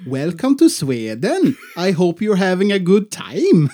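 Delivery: exaggerated sing-song Swedish accent; mock Swedish accent. Host mocking bad impressions, sing-song Swedish accent. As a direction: lilt it like a cringey fake Swede. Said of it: "nothing lamer than the Swedish accent".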